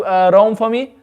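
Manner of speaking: ordinary delivery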